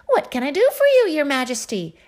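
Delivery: high, bouncy jester voice, eager to help; a playful fool bounding in; the court jester. A narrator's reading. Jester greets the king.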